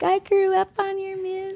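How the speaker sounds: high, breathless fan voice; gushing young fan. Retold or imitated. Young fans telling the singer they grew up on her music, gushing high. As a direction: mimic an eager young fan.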